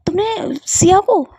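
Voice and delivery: high shocked exclamation, slow; sister-in-law reacting to the kiss confession with a shocked, high cry; shocked outburst. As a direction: blurt it out in disbelief. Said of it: "भाभी चिल्ला क्यों रही हो?".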